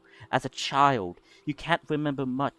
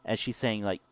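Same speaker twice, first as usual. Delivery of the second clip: usual speaking voice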